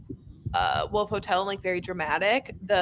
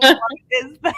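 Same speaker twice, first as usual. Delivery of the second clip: loud laughing mock shout; incredulous laughing shout; laughing outburst; 3 semitones higher, 7 dB louder than usual. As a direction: cry out through laughter. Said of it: "being like".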